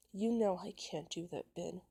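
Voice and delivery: ordinary delivery, nothing marked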